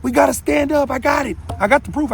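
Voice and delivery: rally the crowd, shouting. Retold or imitated.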